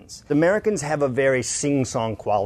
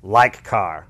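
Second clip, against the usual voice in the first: only "like car", both words punched louder; American key-word emphasis; 3 semitones lower, 5 dB louder than usual. Shown as a demonstration. Coach shows economical American stress, "like car" punched. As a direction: hit "like" and "car" firmly. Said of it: "We only say the words that we mean".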